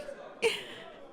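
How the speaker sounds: light, high giggle; soft little giggle after teasing. Acted. Interviewer teasing a shy applicant, light giggle.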